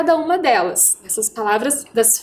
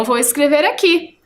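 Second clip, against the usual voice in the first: normal talking voice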